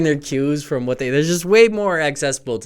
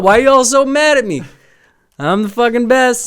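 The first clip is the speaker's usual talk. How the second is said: high cocky boast; a mild mock boast after a home run; mock smug gloating; 9 semitones higher, 4 dB louder than usual. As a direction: brag like you own it. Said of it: "be like".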